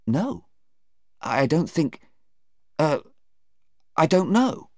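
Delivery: high, halting uncertainty; flustered witness. Acted. Sarah cornered by a toothpaste question, flustered. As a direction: grope for an answer on a high pitch.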